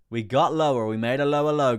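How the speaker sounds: loud, high confirming shout; big booming announcement, falling at the end; excited shout. Real, not acted. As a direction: call out the news at full volume. Streamer announces the new low.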